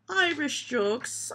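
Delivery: put-on slow, drawn-out Irish accent. Reading the joke heading in a mock Irish accent. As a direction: savour it in a mock Irish lilt. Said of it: "doing it in an Irish accent".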